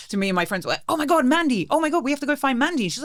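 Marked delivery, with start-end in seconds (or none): 0.9-2.8 high, put-on alarm; feigned urgency. Friends faking an excuse to flee escorts, in mock alarm. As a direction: fake a worried hurry, voice pitched high.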